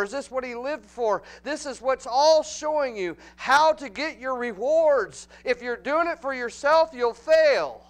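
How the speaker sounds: loud, high, impassioned pulpit cry; preaching at full force. Acted. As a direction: build to a fiery climax.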